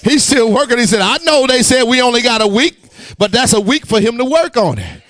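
high, loud, sustained preaching cry; preaching at full force. Acted. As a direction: preach it at the top of your voice. Preaching climax.

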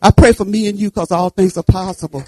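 normal talking voice

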